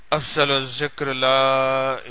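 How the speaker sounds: slow, low, soft chant; devotional dhikr. Real, not acted. Preacher opens the remembrance of God, slow hushed chanting. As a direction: intone it slowly with drawn-out vowels.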